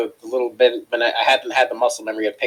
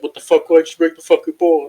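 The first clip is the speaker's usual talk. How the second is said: loud, higher mock yell; retold screaming; 7 semitones higher, 8 dB louder than usual. Supervisor screaming at cook over a broken bowl, imitated yell. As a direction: imitate an outraged boss yelling. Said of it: "This dude starts screaming at me".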